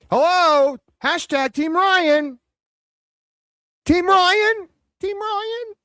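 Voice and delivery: high, singsong hollering for someone; mock calling out. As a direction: call out in an exaggerated high voice, as if searching. Mockingly calling out to absent fans.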